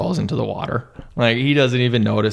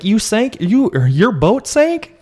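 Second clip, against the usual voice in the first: much higher, slow alarmed mother; high slow shocked mother; shocked mom; 13 semitones higher, 5 dB louder, slower than usual. Retold or imitated. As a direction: gasp it like a horrified mom. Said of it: "The mom's like".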